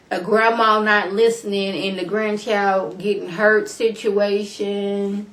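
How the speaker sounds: slow, deliberate list, each situation weighed; slow sarcastic list. Real, not acted. Vlogger mocks the show's pileup of plots, slow and pointed. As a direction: drag out every situation for effect.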